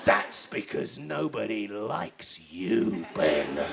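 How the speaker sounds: very low, slow put-down; deep, drawn-out cruel confiding; ominous verdict. Acted. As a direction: drop the voice and land each word slowly. Captain delivering the cruel verdict.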